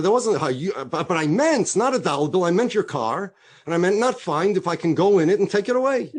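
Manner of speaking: acted, emphatic backpedalling; insistent excuse. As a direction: argue the excuse with mock conviction. Acted car thief's absurd excuse.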